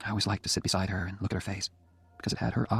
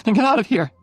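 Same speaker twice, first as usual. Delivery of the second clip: higher and louder, snapping him away; angry outburst; 13 semitones higher, 9 dB louder than usual. A narrator's reading. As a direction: flare up at him, half-playful fury. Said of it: "she said angrily".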